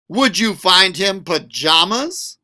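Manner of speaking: ordinary delivery, nothing marked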